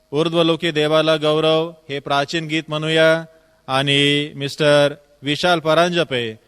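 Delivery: ordinary delivery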